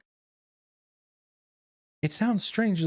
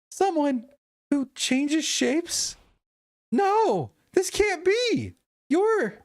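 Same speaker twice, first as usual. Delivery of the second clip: put-on shocked girl voice; 12 semitones higher, slower than usual. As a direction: gasp out the realisation. Voicing Mirabelle's shocked realisation.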